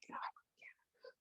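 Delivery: slow hoarse whisper, no voice; fake lost voice. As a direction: pretend you've lost your voice. Faking lost voice to boss.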